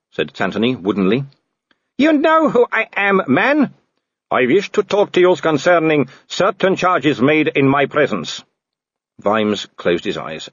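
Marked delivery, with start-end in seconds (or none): 2.0-8.4 imperious Baroness. A narrator's reading. Baroness rebuking Captain Tantony, high and haughty. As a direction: look down on him.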